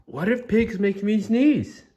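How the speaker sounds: high-pitched, halting dread; dawning alarm. Acted. Gerald has an alarming realization.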